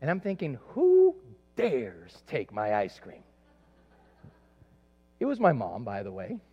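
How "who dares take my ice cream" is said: mock outrage. Child outraged over stolen ice cream.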